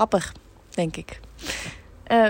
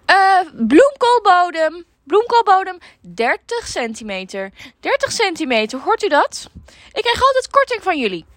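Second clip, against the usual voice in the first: put-on old lady voice; 10 semitones higher, 5 dB louder than usual. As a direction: insist like a bossy old lady. Caller lady voiced in story.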